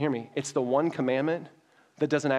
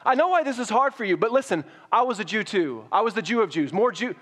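Paul pleading; 6 semitones higher than usual. Pretend. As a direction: urge them like an old friend who gets it. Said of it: "He's like".

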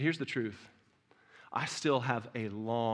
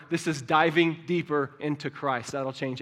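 loud, rising preacher's cry, building to a peak; preaching at full force; 5 semitones higher, 11 dB louder, slower than usual. Acted. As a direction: preach it hard to the church.

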